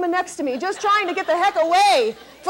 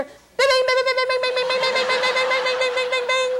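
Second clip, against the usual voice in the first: imitated chime; 7 semitones higher than usual. Imitating the call button chime.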